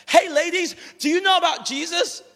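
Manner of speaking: high, loud, booming call-out; hammed-up over-eager street preacher shout; exuberant evangelist. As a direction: accost them loudly, full of zeal. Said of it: "he is very exuberant, very sort of out there".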